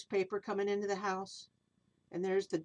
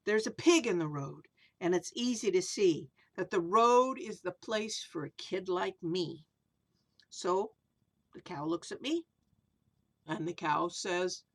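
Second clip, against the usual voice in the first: storybook verse reading; reading to children; 5 semitones lower than usual. A narrator's reading. Reads rhyming picture book aloud.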